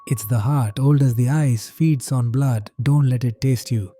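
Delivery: usual speaking voice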